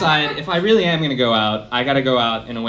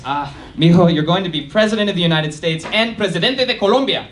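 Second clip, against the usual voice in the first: a louder, higher, gushing mother's voice; proud Colombian mom; 5 semitones higher, 4 dB louder than usual. Retold or imitated. Colombian mom bragging about her son, proud and louder. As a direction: beam with pride over her son's future. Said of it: "mode one is".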